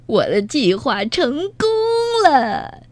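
villain crowing over his plan; weird gloating voice. A narrator's reading. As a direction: gloat in an odd, drawn-out voice. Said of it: "强盗巴巴怪声笑道".

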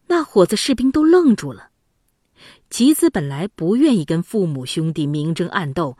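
ordinary delivery, nothing marked

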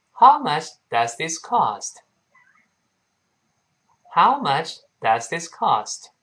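slow, deliberate model reading; each word slow and clearly placed, HOW stressed; model sentence. Shown as a demonstration. Teacher models "How much does this cost?" for learners, slow and deliberate. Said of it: "我们可以说".

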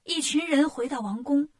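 plain reading voice

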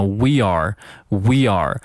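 "we are" joined as "wee-yar"; linked words. Shown as a demonstration. Teacher shows learners linking, "we are" as one unit. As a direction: glide from "we" into "are" with a y sound. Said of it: "它们连在一起变成了".

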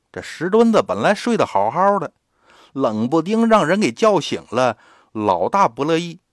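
plain reading voice

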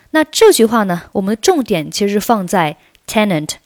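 usual speaking voice

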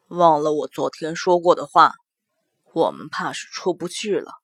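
low, soft grim man's voice. Acted. Mo Jie grim.

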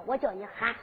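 ordinary delivery

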